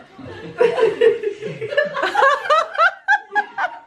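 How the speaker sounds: long high-pitched laughing fit; helpless laughter. Real, not acted. Listener cracking up at the joke, helpless laughing.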